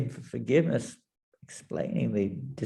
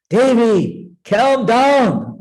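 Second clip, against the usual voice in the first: loud, high, urgent appeal; urgent calming; 10 semitones higher, 9 dB louder, much slower than usual. Acted. Lord Narayan urgently calming raging Parvati. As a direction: call out to stop her rage.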